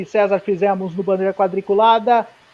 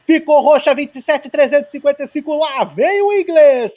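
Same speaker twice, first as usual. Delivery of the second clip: excited; 5 semitones higher, 4 dB louder than usual. Real, not acted. Purple sector called out live.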